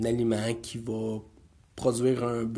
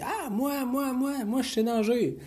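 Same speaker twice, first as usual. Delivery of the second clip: put-on high, eager kid voice; eager little boy; 12 semitones higher, slower than usual. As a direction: pipe up like a keen child. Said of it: "il dit".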